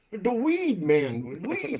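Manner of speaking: lightly voiced Jamaican man, amused and exasperated; Jamaican hustler spelling it out. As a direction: imitate a Jamaican hustler losing patience. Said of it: "He goes".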